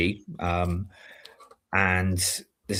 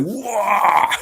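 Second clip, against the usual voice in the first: high, laughing "wah" exclamation; Sid James-style lecherous cry; 11 semitones higher than usual. Shown as a demonstration. Actor ogling a passing courtesan onstage, lecherous laughing cry. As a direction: let out a leering laugh-shout. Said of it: "like a Sid James character".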